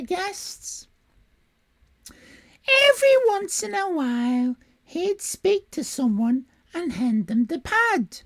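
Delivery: very high sobbing woman's voice; squawky female voice. Acted. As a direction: squawk and sob like a weepy lady. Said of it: "my generic squawky female voice".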